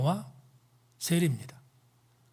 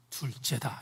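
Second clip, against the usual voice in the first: hushed reveal; 7 semitones lower, 6 dB quieter, slower than usual. Acted. Climactic answer dropped low and soft.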